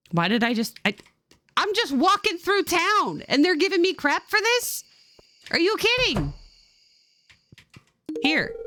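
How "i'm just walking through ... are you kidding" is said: high, indignant outburst; real exasperation. Real, not acted. Gamer venting at townsfolk, high exasperated. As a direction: protest it like you can't believe it. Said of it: "I'm so pissed off right now".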